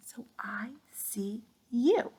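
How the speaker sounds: a soft, slow whispered aside. Shown as a demonstration. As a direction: whisper it slowly like a little secret. Whispered playful line to children.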